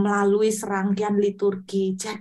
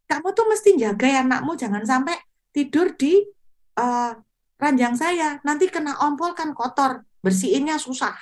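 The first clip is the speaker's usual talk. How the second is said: higher-pitched, hurried scolding; imitating an irritated landlady telling off a tenant; mock scolding, pitched up; angry landlady; 6 semitones higher than usual. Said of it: "Lalu ibu kos marah-marah".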